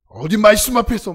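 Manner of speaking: loud shouted order; yelling pastor. Retold or imitated. Senior pastor shouts at the campers, loud command. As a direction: bark it at the sleepy kids. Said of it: "왜 이렇게 소리를 지르시는지".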